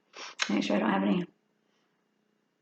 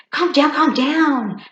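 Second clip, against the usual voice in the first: slow, higher and louder calm down; mock calm down; 7 semitones higher, 8 dB louder, slower than usual. To someone yelling at you, slow loud calm down. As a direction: talk someone down in a big slow voice. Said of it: "you go".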